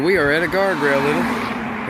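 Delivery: ordinary talk, nothing marked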